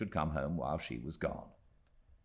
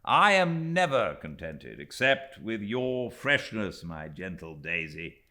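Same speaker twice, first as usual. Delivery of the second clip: merrily; 4 semitones higher, 8 dB louder than usual. A narrator's reading. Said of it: "he answered merrily".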